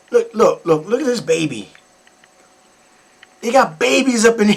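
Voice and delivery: usual speaking voice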